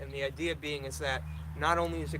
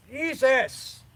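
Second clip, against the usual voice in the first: sudden loud frustrated cry; real exasperation, loud; exasperated outburst; 10 semitones higher, 9 dB louder than usual. Real, not acted. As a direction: blurt it out, fed up with the dying iPad. IPad dies mid-sentence, outburst.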